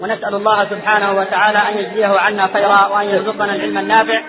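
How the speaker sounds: measured, solemn closing address; formal closing prayer. Acted. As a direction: close the session with a steady formal prayer.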